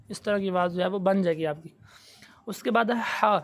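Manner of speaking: normal talking voice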